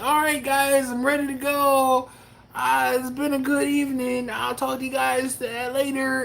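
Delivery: playing himself eager to end the stream; mock sign-off. Teasing Preston, he mimics his own good-night in a high mock voice. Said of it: "when I'm like".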